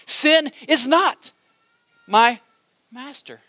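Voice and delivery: slow, word by word with pauses; dramatic pauses. Acted. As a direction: let each word land. Preacher slows for dramatic effect.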